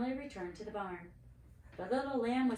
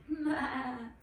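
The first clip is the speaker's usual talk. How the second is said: higher lamb bleat; 5 semitones higher, 4 dB louder than usual. A narrator's reading. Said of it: "he bleated".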